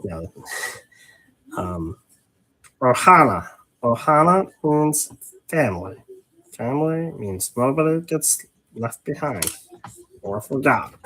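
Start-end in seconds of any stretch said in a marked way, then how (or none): 2.8-11.0 cartoon alien creature reciting solemnly; Stitch voice. Streamer quoting the family motto on request, Stitch voice. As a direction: put on the little blue alien's voice. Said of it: "Was it a good Stitch voice?".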